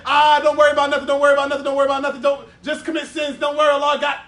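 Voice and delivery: loud, careless "don't worry" rant; mocking Murji'ah. Preacher mocking the Murji'ah, loud and carefree. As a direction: shout it like a carefree sinner brushing off sin. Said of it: "the Murji'ah who also say".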